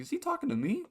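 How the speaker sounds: wondering if the stranger means you, pitched high; confused. Retold or imitated. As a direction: squeak it out in confusion. Confused in parked car.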